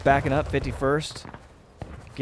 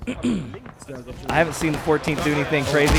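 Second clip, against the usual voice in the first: ordinary talk, nothing marked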